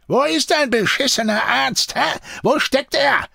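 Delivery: high, heated demand; angry interrogation. A narrator's reading. Deserter demands the doctor's whereabouts from his captive, angry. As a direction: press the prisoner furiously for an answer. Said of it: "zornigen Schritten".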